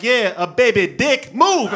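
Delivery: high, drawn-out jeer, then loud order; loud comeback. Retold or imitated. Woman on the train to the man, loud mocking comeback. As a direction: slam the comeback home loudly and slowly.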